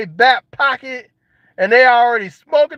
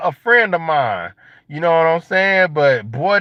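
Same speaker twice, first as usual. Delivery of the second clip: usual speaking voice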